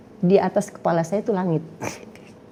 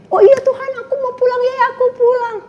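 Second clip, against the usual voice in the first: put-on high, eager child's voice; high childish pleading; childlike eager plea; 4 dB louder than usual. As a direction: beg like a little child. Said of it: "kayak anak kecil".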